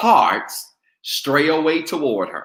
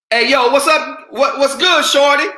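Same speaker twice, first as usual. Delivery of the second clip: street catcall; 13 semitones higher, 7 dB louder than usual. Retold or imitated. Mock catcall in sermon.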